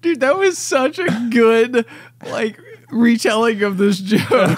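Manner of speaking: high, laughing praise through giggles; breathless laughing delight. Real, not acted. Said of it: "laughing reaction to joke".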